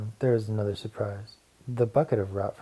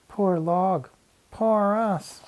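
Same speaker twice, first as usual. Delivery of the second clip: high mournful wail; lamenting; 10 semitones higher, 4 dB louder, much slower than usual.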